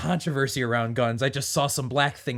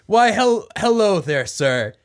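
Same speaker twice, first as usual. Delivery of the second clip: awkward greeting; 6 semitones higher, 6 dB louder, slower than usual. Acted.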